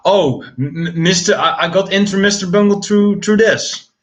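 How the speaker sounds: high-pitched stammering mockery; mocking the messenger. Streamer mimicking [name]'s messages about Mister Bungle, high mocking voice. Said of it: "sending me these fucking messages about".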